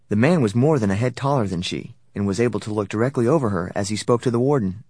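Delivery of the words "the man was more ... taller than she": ordinary delivery, nothing marked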